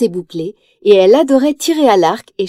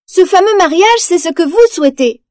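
defiant retort; 8 semitones higher, 3 dB louder than usual. Acted. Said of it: "en agitant son épée".

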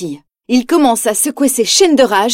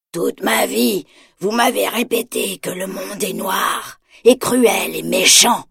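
bitter hunchback voice; 3 dB quieter, slower than usual. A narrator's reading. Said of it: "dit-il à son bourreau".

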